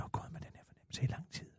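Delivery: hushed gossip whisper, breathy and secret. Real, not acted. Gossiping host whispers the scandal to his co-host, hushed. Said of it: "Hvor visker du?".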